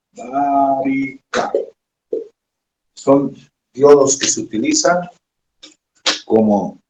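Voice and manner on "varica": dictating slowly. Shown as a demonstration. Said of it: "o varica".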